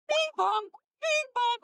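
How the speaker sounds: high, ringing bing-bong; imitated device chime. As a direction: mimic an annoying game jingle on repeat. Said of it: "it's the same three sounds".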